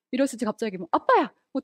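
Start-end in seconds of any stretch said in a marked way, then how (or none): 0.9-1.3 mock startled cry.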